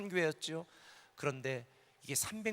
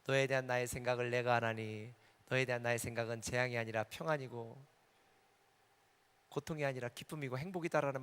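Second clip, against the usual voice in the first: low, solemn scripture recitation; scripture quote; 5 semitones lower than usual. Retold or imitated. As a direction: recite the verse as God's word, lower. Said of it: "에레미야 삼 장 삼십삼 절".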